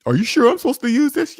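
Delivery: high, unsure naive voice; naive worker. Pretend. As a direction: ask it like a timid worker who suspects a trick. Voices slave asking.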